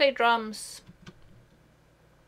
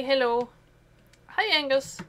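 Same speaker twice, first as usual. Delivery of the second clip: normal talking voice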